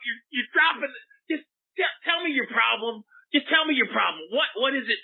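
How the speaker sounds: high, whiny pleading; pleading whine. Acted. Host begging the swearing caller to calm down, high whiny plea.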